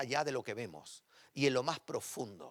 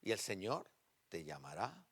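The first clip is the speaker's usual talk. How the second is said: tender prophetic word; 3 semitones lower, 6 dB quieter than usual. Retold or imitated. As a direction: speak it gently, like a comfort from God. Retold prophetic word to pastor.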